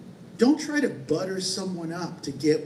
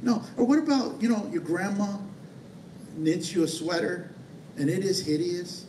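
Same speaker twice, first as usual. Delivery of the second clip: ordinary talk, nothing marked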